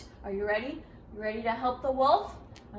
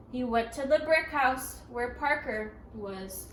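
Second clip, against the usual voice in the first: ordinary delivery, nothing marked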